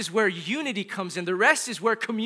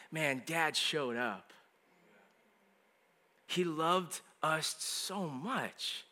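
soft, slow, lower voice with lingering pauses; tender tribute; 5 semitones lower, 11 dB quieter, much slower than usual. Acted. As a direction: say it quietly, letting each word land. Imagining his family's future tribute.

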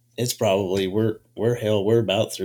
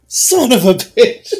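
higher and louder mock curse; mock exasperation; 12 semitones higher, 10 dB louder, slower than usual. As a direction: blurt it out in amused disbelief. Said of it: "we're like".

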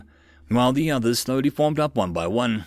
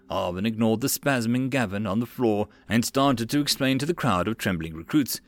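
plain reading voice

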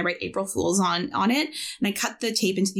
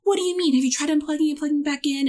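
high, quickening mock incredulity; hammed-up, high-pitched helpdesk fussing; over-the-top extra; 7 semitones higher, faster than usual. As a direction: imitate an over-dramatic office coordinator. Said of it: "she was so extra".

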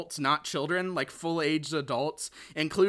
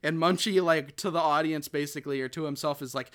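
ordinary delivery

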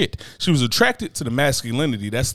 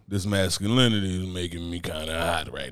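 mock turned-on murmur; 7 semitones lower, 7 dB quieter than usual. Man imitating a woman attracted to his masculinity, mock turned-on murmur. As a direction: murmur it as if secretly aroused, hammed up.

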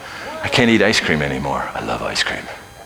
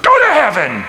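high, loud yell across a car park; 6 dB louder than usual. Retold or imitated. Preacher yelling at shoppers in a parking lot, loud holler. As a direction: holler it at strangers. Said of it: "I just yell out".

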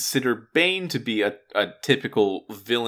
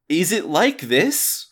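high, slow tentative Bane voice; Bane voice trial; 6 semitones higher, slower than usual. Retold or imitated. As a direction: try out the voice, unsure. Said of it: "first did that voice".

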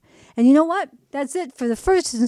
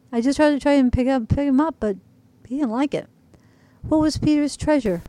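plain reading voice

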